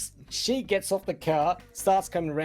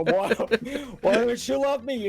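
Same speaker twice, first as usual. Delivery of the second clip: higher, mock-insistent plea; retold growing anger; 6 semitones higher than usual. Woman confronting him as he packs up, mock anger rising. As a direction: imitate her getting angrier, pitch climbing. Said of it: "she's getting angry and angry".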